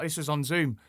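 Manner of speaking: usual speaking voice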